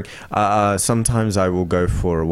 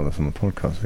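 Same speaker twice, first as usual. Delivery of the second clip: usual speaking voice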